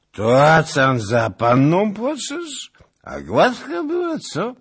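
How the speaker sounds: put-on high, loud, slow leering drunk voice; drunken mockery. A narrator's reading. Drunk nobleman taunts grieving lieutenant, high and leering. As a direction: jeer at his grief like a drunk.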